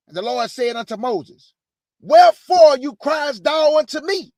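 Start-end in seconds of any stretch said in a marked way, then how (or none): 2.0-4.3 higher, louder, thundering Bible line; loud scripture read. Acted. Preacher reading God's rebuke to Moses, loud and high. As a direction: boom the Lord's rebuke.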